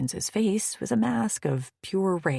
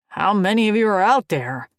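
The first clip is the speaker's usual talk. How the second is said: loud, gruff demand with a raised pitch; growled; 5 semitones higher, 9 dB louder than usual. A narrator's reading. Alien warrior demands answers from the crowd outside, loud and gruff. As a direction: push them off with a rough loud question. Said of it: "he growled".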